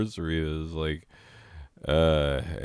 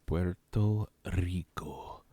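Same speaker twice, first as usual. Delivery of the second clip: breathy whisper on Rico; 4 dB quieter than usual. Pretend. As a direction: hush it, no voice.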